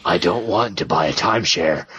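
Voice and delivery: put-on disguised phone voice; voice disguiser. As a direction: play at masking your voice for a telemarketer. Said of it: "With a little voice disguiser".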